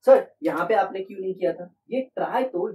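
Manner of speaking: high and loud pupil's protest; objecting student voice. Pretend. Teacher acting a student questioning the tri prefix. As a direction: catch sir out like a keen student.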